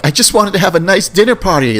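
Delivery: high-pitched acted complaint; mock exasperated lament. Wife whose dinner party is ruined, mock exasperated. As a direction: imitate an upset hostess pleading.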